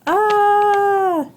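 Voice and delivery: "Ah" held long and high; teacher drawing out a high "Ah"; sounding out. Shown as a demonstration. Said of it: "What letter says ah".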